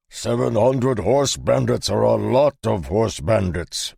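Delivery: loud, slow, heavy mercenary; big mercenary, slowly grim; gruff mercenary voice. A narrator's reading. Belsker's voice.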